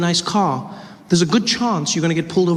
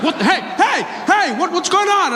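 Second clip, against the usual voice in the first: high, alarmed "what the, hey"; a startled policeman's high yelp; startled officer; 11 semitones higher than usual. Retold or imitated. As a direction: play the shocked cop jumping back. Officer freaked out.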